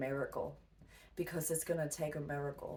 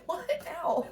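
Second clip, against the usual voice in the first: laughing exasperation; 13 semitones higher, 3 dB louder than usual. Retold or imitated. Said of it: "I was like".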